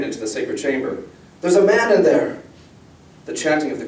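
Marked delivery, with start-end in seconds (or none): 1.4-2.4 high, loud cry of alarm; urgent alarm. A narrator's reading. Girl warning her father of a stranger inside, urgent alarm. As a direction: call out a warning, pitched well up.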